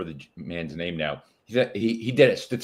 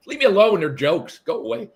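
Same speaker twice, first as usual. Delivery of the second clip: exasperated brush-off; 7 semitones higher, 4 dB louder than usual. Retold or imitated. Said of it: "I'm like".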